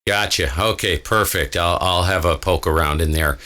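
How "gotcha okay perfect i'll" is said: normal talking voice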